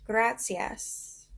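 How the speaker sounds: gracias said slowly as KRAH-see-ahs, demonstrated for repeating; modeling Spanish word. Shown as a demonstration. Teacher modeling the Spanish word gracias, clear demo. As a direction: say gracias clearly for the child to copy. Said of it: "Can you say gracias?".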